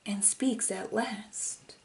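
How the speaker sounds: slow suspenseful whisper. A narrator's reading. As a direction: hush the room before he speaks. Suspense before fish speaks.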